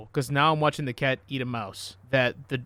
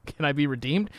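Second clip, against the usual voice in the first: normal talking voice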